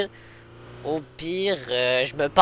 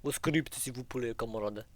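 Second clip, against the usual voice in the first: put-on broken Russian-accented French. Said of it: "faire mon meilleur accent russe".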